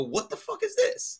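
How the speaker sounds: high, outraged What the fuck is this; a boss shocked by bad work; mock outraged boss. Boss mocking an employee's project, outraged high yelp. Same boss reaction repeated.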